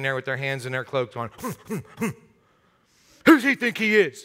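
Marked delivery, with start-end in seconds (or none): 1.4-2.1 quiet huffy grunts; three short, soft huffs of disapproval; disapproving hmph. Acted. Religious leaders standing aside with hands in cloaks, huffing. 3.2-4.2 loud, indignant scoff; indignant leader. Acted. Religious leaders grumbling about Jesus, indignant.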